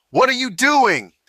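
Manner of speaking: loud, high, demanding yell; officer's shout. A narrator's reading. Officer sprints at Alex demanding answers, loud yell. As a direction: shout the question at him as you run over. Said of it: "The voice belonged to a young police officer".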